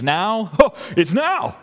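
put-on high excited little girl, laughing; a child's high laughing "Oh, it's now"; playful child voice. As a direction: act out an eager child realizing it's now. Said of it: "because she thinks".

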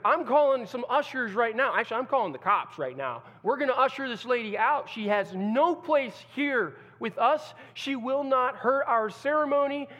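loud, high protective fury; angry groom. Acted. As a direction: command it, no room for argument. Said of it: "how's the groom gonna respond?".

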